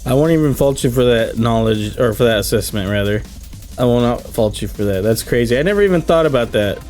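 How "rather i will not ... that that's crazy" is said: ordinary talk, nothing marked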